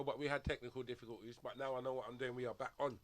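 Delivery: usual speaking voice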